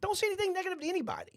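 high-pitched, fast exasperation; high, rapid protest; exasperated plea. Real, not acted. Exasperated riff on negative politics.

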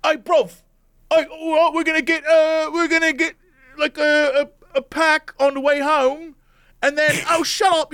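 hammed-up bro chatter; rowdy guys on the phone. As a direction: imitate obnoxious lads talking at the cinema. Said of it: "these four guys are just like".

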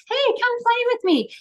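high, bright sing-song invitation; cheery parent invite. Retold or imitated. Parent coaxing a strong-willed child, high sing-song. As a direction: chirp it up high like coaxing a toddler.

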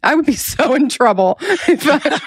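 real laughter breaking through the words; laughing. Real, not acted. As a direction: giggle helplessly at your own thought. Imagining herself on trial.

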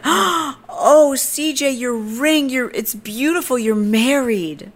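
gasp, then very high, drawn-out gushing; hammed-up high-pitched admiring squeal; mock gushing. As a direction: mimic her cooing over a discovery. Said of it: "And she's like".

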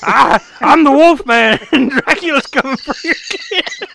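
high, loud mock-panicked warning; comically desperate monster shout; frantic wolfman. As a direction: yell it like a hammed-up wolfman on the phone. Acting out the frantic phone call.

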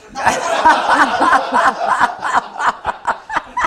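long high peals of laughter. Real, not acted. Guest reacting to co-host's rainy-day joke, loud laughter. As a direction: crack up, loud and sustained.

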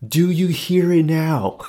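high, loud, slow mock challenge; triumphant call-out. To the attendant while the buzzer rings, loud triumph.

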